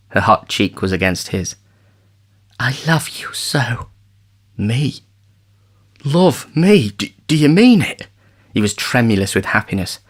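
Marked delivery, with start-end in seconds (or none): 2.6-3.9 soft, slow loving words; tender confession. A narrator's reading. She confesses love softly. 4.6-8.1 voice breaking. A narrator's reading. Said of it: "his voice broke".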